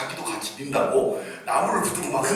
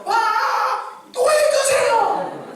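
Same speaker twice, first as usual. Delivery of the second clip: much higher, louder, stretched out and laughing; a five-year-old begging for more, squealing with joy; excited child squeal; 11 dB louder, much slower than usual. Retold or imitated. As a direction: squeal like a thrilled little kid.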